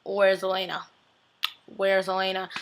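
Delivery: put-on low, quiet drunken mumble; lightly acted boozy murmur; imitated drunk. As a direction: play a subdued drunk asking around. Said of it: "he looks drunk or something".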